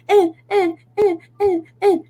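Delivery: high nasal mock whining; imitated toddler whine. Mom imitating her toddler's back-seat whining. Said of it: "like, whines".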